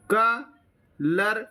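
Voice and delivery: Colourful split into क, लर, फुल with pauses; syllable by syllable. Shown as a demonstration. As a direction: pitch the start of colourful high and separate each beat. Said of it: "आपको बोलना है ऐसे ही मेरी तरह".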